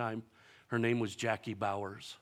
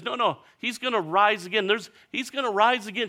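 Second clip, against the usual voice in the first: a hint of reassuring Jesus; 11 semitones higher, 7 dB louder than usual. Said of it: "Jesus says".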